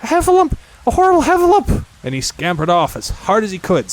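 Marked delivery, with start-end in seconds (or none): 0.0-1.8 high-pitched terrified yelling; Piglet's panicked cry. A narrator's reading. Piglet sees a heffalump, shrill panicked cry. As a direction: squeal it out in loud alarm. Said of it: "cried Piglet".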